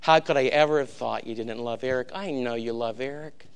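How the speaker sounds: tearful plea. Retold or imitated. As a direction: retell it gently, as if choking up a little. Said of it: "the tears rolling down his face".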